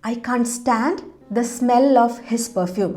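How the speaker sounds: very slow, careful dictation pace; slow model sentence. Shown as a demonstration. Teacher modelling for Malayalam viewers, very slow and clear. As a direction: spell it out word by word for learners. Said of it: "നമ്മളെങ്ങനെയാ പറയാ".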